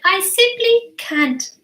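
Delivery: high, drawn-out protest; baby dinosaur protesting. Acted. Baby dinosaur says he cannot eat meat, protesting. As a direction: plead slowly in a high little voice. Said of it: "And he said".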